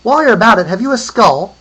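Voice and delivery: high, loud lady calling out; lady's voice. A narrator's reading. Lady asks a trader for a skull, calling out. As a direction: pitch it up and holler as a lady. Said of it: "came a lady's voice then".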